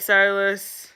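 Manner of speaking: ordinary talk, nothing marked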